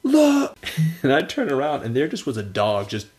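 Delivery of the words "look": high shouted call of a name; high excited call to look; yelling a name. Retold or imitated. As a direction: yell to make him look. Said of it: "He was like".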